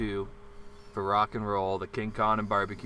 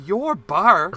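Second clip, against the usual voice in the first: higher, louder scornful challenge; retold indignant yell; 6 semitones higher, 8 dB louder than usual. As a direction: throw his words back at him. Retold scoff at bartender.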